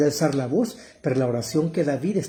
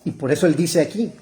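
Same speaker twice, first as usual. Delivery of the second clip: ordinary talk, nothing marked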